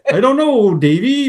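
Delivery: mock dopey cartoon dog voice; hammed-up doubtful claymation dog voice; Goliath the dog. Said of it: "So perfect".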